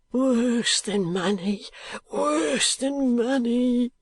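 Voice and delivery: high, slow anguished wail; crying out. Acted. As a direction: drag it out in despair. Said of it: "cried the old man".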